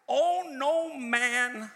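high, slow, drawn-out "Owe no man"; proclaimed scripture. Real, not acted. Preacher quoting the Bible to the congregation, proclaiming.

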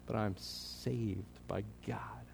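hushed, slow whisper of faith; whispered confession. Pretend. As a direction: breathe it out slowly as a secret. Imagined believer's quiet claim.

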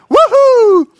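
very high, loud stadium whoop; fan whoop. Pretend. Shirtless fan cheering in the cold, loud high whoop.

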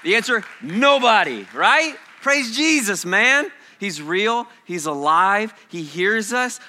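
loud, high, fervent preaching; shouted worship preaching; full-force preaching. Real, not acted. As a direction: lift the room with praise.

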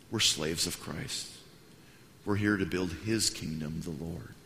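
low, soft, measured statement; slow down and let it fade quietly; humble sincerity. Retold or imitated. Pastor answers modestly on camera, humble.

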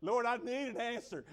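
loud, high plea to the Lord; mock-frantic test-day prayer; desperate praying kid. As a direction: beg God out loud, played for laughs.

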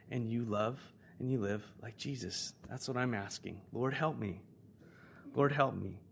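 hushed, earnest appeal; prayerful reflection. Real, not acted. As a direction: gently lead them to search their hearts. Leads congregation into prayerful reflection.

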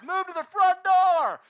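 very loud, high, slow yell; shouted instruction. Retold or imitated. Pastor yells at the woman banging on the garage door. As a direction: yell it across the yard. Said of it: "I was like".

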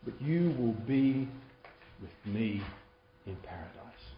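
slowing, low and hushed assurance; tender promise. Acted. Jesus to the thief, tenderly promising paradise. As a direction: soften steadily to a near murmur. Gentle promise against grudging version.

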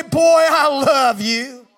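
slow put-on accent of a doting father; father Isaac hugging his son, in a put-on accent. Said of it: "Maybe not with that same accent".

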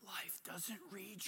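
slow unvoiced whisper to the congregation. Real, not acted. Preacher whispering a hard truth to the congregation. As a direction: lean in and breathe the words.

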